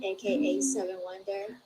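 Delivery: ordinary delivery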